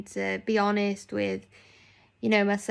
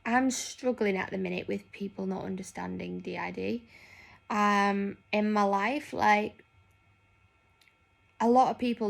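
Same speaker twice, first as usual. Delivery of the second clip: ordinary talk, nothing marked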